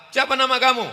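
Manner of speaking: high, loud, fast grilling of the suitor; stern examiner. Acted. Examiner questioning a would-be son-in-law, high and loud. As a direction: bark the question down at the young man. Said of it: "maka Ustadz Somad yang akan ngetes".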